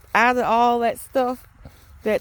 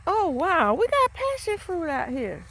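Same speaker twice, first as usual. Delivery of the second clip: higher-pitched, delighted discovery; real surprise, pitched up; pleasant surprise; 6 semitones higher than usual. Real, not acted. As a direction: marvel at an unexpected find. Spots passion fruit growing outside.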